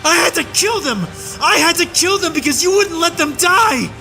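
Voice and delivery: high shouting, cracking with anguish at the peaks; a soldier screaming out what he witnessed; anguished rage. A narrator's reading. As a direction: let grief and fury break through the yell. Tirade over remembered horrors.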